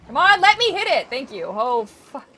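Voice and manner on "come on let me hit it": loud high yell at the game; shrieking battle cry; frantic yell. Real, not acted. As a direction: yell it out, desperate to land the hit. Fighting alpha in game.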